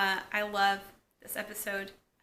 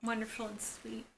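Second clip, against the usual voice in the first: hushed whisper; 9 dB quieter than usual. Real, not acted. Vlogger whispering how sweet the scene is. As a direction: breathe it out, voiceless and soft. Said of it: "And it's so".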